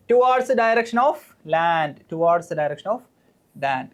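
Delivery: very slow, word by word dictation; dictating the answer. Shown as a demonstration. Teacher dictates the answer so students can write it, very slowly. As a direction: drag out towards the direction of land for note-taking. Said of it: "dictates the answer".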